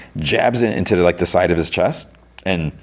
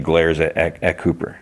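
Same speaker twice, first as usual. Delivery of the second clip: ordinary talk, nothing marked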